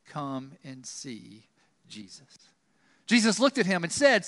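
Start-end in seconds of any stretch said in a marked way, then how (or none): 0.1-2.5 tender trusting invite. Shown as a demonstration. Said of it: "in a relationship of trust".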